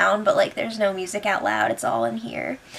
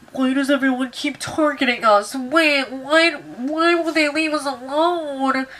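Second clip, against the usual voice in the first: whiny mock Jared. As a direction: whine it like a put-upon houseguest. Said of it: "He's all like".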